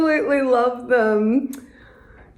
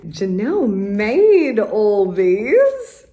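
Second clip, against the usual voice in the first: ordinary talk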